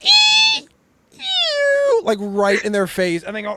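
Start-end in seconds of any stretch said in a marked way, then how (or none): 0.0-2.0 very high, loud squealing chicken noise; piercing rubber-chicken shriek; rubber chicken scream. Retold or imitated. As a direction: squeal it out the car window like a toy chicken. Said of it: "I literally put out the window and I go".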